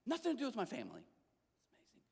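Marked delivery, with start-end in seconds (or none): 1.0-2.0 slow, hushed whisper; whispered awe. Real, not acted. Telling the crowd about God's love, whispering. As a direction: barely breathe it out.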